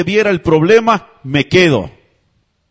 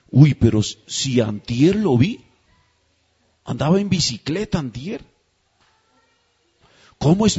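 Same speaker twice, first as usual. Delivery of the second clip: shocked mourner; 5 semitones lower, 4 dB quieter than usual. Retold or imitated. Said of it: "Y uno dice".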